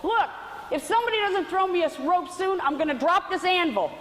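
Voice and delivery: high-pitched, desperate yell for effect; desperate mock holler. Drowning skinny guy threatens his would-be rescuers, desperate mock holler. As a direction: holler it as a comic last warning. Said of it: "in desperation, he hollers out".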